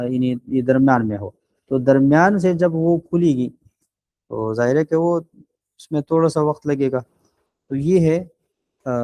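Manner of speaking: usual speaking voice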